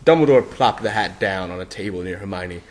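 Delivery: plain reading voice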